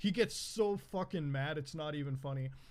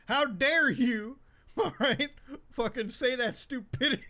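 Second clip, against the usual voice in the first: imitating angry dad; 6 semitones higher, 7 dB louder than usual. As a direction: blast it like a furious father scolding his kid. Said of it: "He's like".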